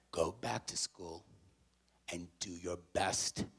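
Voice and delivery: slow, low, soft reassurance; tender motherly encouragement. Retold or imitated. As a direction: send him off gently, word by word. Mother consoles and sends son back.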